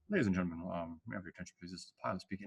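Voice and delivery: soft, low pilot over the intercom; mock pilot announcement. Teacher playing a pilot for the class, soft mock announcement. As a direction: play the airline captain murmuring into the mic. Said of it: "I can pretend I'm a pilot".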